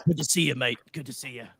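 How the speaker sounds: put-on low, soft English-accent creature voice; mock English accent. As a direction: greet like a gruff English bloke, in accent. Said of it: "the accent that they have".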